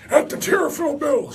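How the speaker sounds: put-on high, far-off creaky old-timer voice; old man voice. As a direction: say it like a little girl doing an old man. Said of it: "She says it like an old man".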